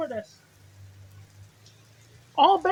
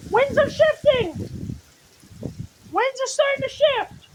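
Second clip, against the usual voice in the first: tense excited; 6 semitones higher, 3 dB louder than usual. Real, not acted. Storm arriving.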